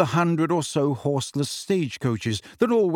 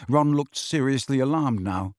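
ordinary delivery

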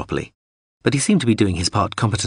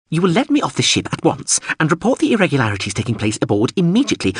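higher, huffy little robot voice; prim machine protesting, pitched up; indignant drone voice; 9 semitones higher than usual. Acted.

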